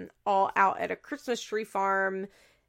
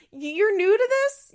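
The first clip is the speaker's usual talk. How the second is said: high, slow, louder mocking; sarcastic disbelief; 12 semitones higher, 8 dB louder, slower than usual. Real, not acted. Host ridiculing Janelle, slow high sarcasm. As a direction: draw it out incredulously. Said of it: "mocking Janelle".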